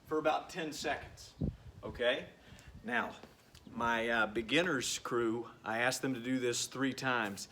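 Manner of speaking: ordinary delivery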